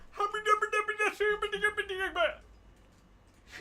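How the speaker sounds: fast made-up foreign babble; mock gibberish language. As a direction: rattle off fake foreign syllables. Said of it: "And then he went".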